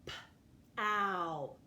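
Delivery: "P-ow" as a puffed p, then held ow; sounding out. Shown as a demonstration. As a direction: separate the p from a long ow in "P-ow". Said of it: "start with the first syllable".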